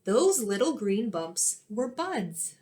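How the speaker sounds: normal talking voice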